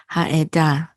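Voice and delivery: Ha'edah with the accent on the final "dah"; Ha'edah, stressed at the end. Shown as a demonstration. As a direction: lean on the last syllable of Ha'edah. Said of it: "this is the accent on Da".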